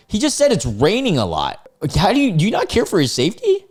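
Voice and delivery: high, quick scolding question; indignant disbelief. Real, not acted. Indignant reaction to her messages.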